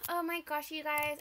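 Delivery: ordinary talk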